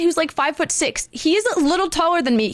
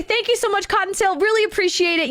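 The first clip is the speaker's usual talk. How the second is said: higher-pitched retold thank-you; grateful thanks; 6 semitones higher than usual. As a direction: thank the dispatcher in a lightly acted high voice.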